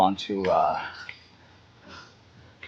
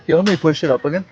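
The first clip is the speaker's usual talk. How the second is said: raised, higher repeat to be heard; louder; 7 semitones higher, faster than usual. Real, not acted. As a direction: call it out over the gym.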